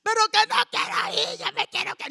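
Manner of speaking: high, loud whining refusal; whiny teenager. Retold or imitated. The preacher as a teen whining to her mother. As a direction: complain like a sulky girl.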